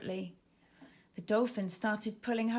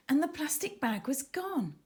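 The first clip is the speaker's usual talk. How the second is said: usual speaking voice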